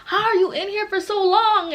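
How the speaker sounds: high-pitched, put-on questioning voice; incredulous friends. Friends questioning her long jacuzzi soak, incredulous. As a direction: imitate friends raising their voices in disbelief.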